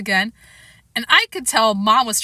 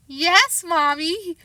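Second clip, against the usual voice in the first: put-on high sweet innocent child voice; best little boy voice; 8 semitones higher, much slower than usual. Boy charms his angry mother, sweet voice. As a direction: play the angel child. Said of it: "best little boy in the world voice".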